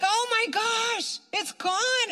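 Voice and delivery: high, drawn-out awe; retold amazement. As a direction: relive the astonishment, stretching it out.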